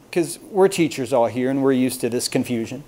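ordinary talk

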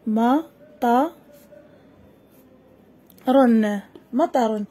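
each word drawn out slowly for pupils; patient classroom model reading, slow. Shown as a demonstration. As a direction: read it slowly like a teacher modelling for first graders. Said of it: "الصورة الأولى مطر".

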